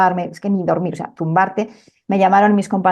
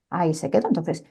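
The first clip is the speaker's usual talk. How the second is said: ordinary delivery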